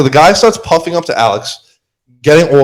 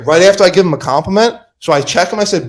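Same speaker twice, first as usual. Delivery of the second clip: ordinary delivery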